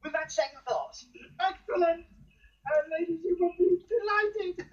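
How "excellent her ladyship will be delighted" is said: high, loud, effusive delight; excited, raised voice. Acted. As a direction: gush with relief and pleasure. Pleased they will do the job.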